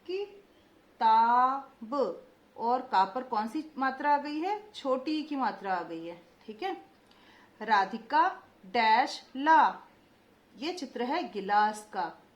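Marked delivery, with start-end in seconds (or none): 0.1-1.9 slow drawn-out word. Shown as a demonstration. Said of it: "देखते जाइए मैं कैसे उच्चारण कर रही हूँ". 7.6-9.8 slow, louder, every syllable clear; slow dictation. Shown as a demonstration. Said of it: "देखते जाइए मैं कैसे उच्चारण कर रही हूँ".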